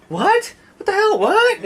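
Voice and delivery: very high, loud What the hell; exasperated disbelief. Shown as a demonstration. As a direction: shriek it in outrage. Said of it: "which means".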